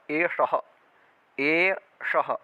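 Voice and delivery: एषः with retroflex ष; retroflex sh in eshah. Shown as a demonstration. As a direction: say एषः with the षटकोण श, not स. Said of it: "दंत्य स सगड़ी का स है उसका षटकोण का श हो गया". (षटकोण श वाला एषः)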